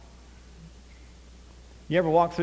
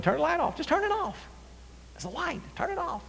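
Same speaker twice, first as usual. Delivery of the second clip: high-pitched insistence, getting softer; mock squeaky nagging; 11 semitones higher, 7 dB quieter than usual. Light turner offer squabbles with someone in the room, mock high nagging. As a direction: nag in a hammed-up high voice.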